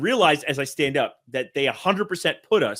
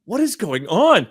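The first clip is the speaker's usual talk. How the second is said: surprised; 7 semitones higher, 5 dB louder, slower than usual. Retold or imitated. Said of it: "and I'm like".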